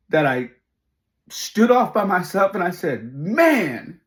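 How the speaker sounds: very high, loud, impassioned outpouring; overcome with pride. Real, not acted. Father declaring this one was for Dad, overcome with pride. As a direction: cry it out, overwhelmed by triumph.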